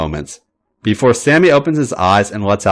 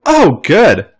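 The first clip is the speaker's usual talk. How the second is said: higher, louder bright cheer; cheerily; 8 semitones higher, 4 dB louder than usual. A narrator's reading. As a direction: sound innocently pleased with yourself. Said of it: "cheerily adding".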